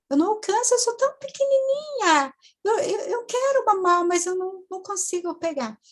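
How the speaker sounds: put-on very high, distressed little child; childlike voice. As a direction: wail it like a helpless toddler. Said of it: "de criança, uma voz bem infantil".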